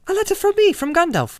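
excited cry. A narrator's reading. Frodo cries out on hearing of Gandalf's letter, excited. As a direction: burst out with excitement. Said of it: "cried Frodo".

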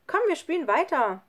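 high, loud invitation; eager urging. A narrator's reading. Schlunz wants Lukas to keep playing, eager. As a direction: urge him to join in.

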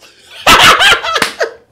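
high, loud helpless laugh; loud burst of laughter. Real, not acted. Big laugh at the video.

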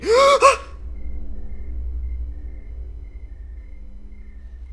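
sharp, high gasp of fright; startled gasp. Real, not acted. Player jumped by something in the game, startled gasp. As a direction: gasp loudly at a sudden scare.

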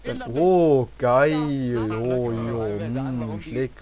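goofing around with odd noises; a joking drool over candy; silly sayings. Pretend. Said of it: "dass ich komische Sprüche mache".

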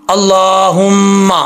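अल्लाहुम्मा slowly, nasal mm held; ghunna recitation. Shown as a demonstration. Teacher recapping the count, held ghunna in अल्लाहुम्मा. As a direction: linger on the humming m in अल्लाहुम्मा. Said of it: "गुन्ने की मिकदार आपको मैंने बता दी".

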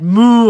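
loud cow moo; big lowing cow call. A narrator's reading. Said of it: "the cow said".

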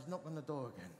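soft, low imagined prompting; little inner voice. Retold or imitated. Inner voice prompts the shy vicar, little quiet voice. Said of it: "this little voice inside says".

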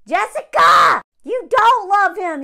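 loud, high insistent call; emphatic shout. Real, not acted. Urging Jessica she never loved him, loud emphatic call. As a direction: shout the truth at her. Telling letter writer directly.

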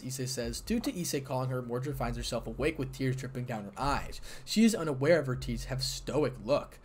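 usual speaking voice

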